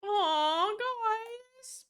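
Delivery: high, cooing aw with a lift on guys; touched aw. Real, not acted. Streamer imagining guildmates' tribute, high touched coo. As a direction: melt at the thought of the guild's shrine.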